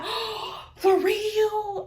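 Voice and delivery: hammed-up gasping surprise from the friend; mock surprised friend. Friend's reaction voiced in story.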